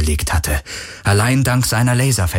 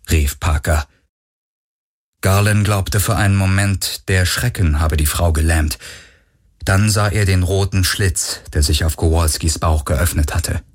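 usual speaking voice

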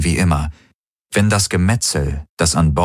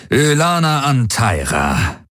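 higher, slow drawn-out address; barely suppressed anger; 6 semitones higher, slower than usual. A narrator's reading. Field commander confronting Ylana, barely suppressed anger. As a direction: let the anger leak through the name. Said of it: "mit schlecht unterdrückter Wut".

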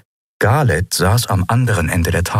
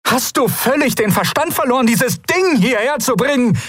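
much higher, furious outburst. A narrator's reading. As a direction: she explodes at her adviser. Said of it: "rief sie". (wütender, viel höherer Ausruf)

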